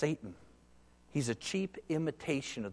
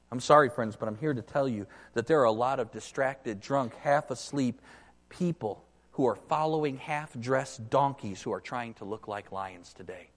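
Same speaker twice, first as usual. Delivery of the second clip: ordinary delivery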